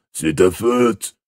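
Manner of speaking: slow, drawn-out accusing character voice; Boyle grumbling blame. Acted.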